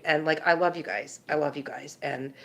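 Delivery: letting the feeling show as it fades; emotional. Real, not acted. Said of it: "I'm sorry I got emotional".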